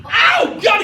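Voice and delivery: very high cry of pain, acted; hammed-up high-pitched agony; mock pained yelp. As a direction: yelp as if poked in the eye. Father reacts to eye poke.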